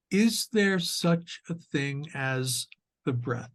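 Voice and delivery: measured meditation guide with long pauses; guided meditation. Acted. Teacher leading sitters into awareness of the breath, guided meditation voice. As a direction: guide listeners gently through posture and breath, unhurried.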